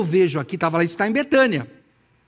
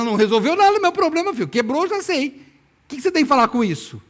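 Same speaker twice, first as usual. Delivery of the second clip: higher, louder, fast acted complaint; irritated retort; 7 semitones higher, 3 dB louder, faster than usual. Broken-vase owner answers someone offering words, irritated. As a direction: fire back in mock exasperation. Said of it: "alguém chega e fala pra você assim".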